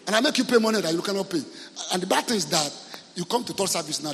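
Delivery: usual speaking voice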